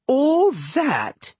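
high-pitched amazed question; astonished sister, voice lifted high; marveling. A narrator's reading. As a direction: pitch it right up in wonder. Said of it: "Sadie marveled".

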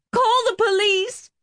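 very high-pitched shriek. A narrator's reading. Helen jerking back from Marie, shrieking. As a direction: shriek for help, pitched right up. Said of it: "Helen began to shriek".

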